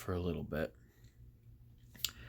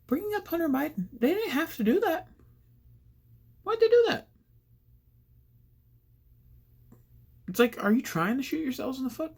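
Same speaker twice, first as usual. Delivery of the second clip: exasperated. Real, not acted. Exasperated rant about news choice.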